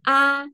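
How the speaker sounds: A held high and level; first tone. Shown as a demonstration. Demonstrating the tones to students, first tone. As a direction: hold A on a high, level pitch. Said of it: "nada satu".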